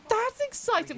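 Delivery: high excited gasp. Real, not acted. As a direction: gasp with delight. Gasps at viewer news.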